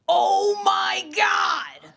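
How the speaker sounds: high, stretched-out mock fanboy cry; overexcited anime fan. Anime fan to a Japanese speaker, hammed-up excited cry. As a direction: imitate a fan proclaiming himself, slow and high. Acting out anime fan's insane reply.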